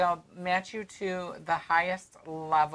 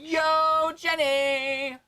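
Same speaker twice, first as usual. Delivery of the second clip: calling out; 12 semitones higher, 5 dB louder, much slower than usual. Real, not acted. Greeting a viewer, calling out.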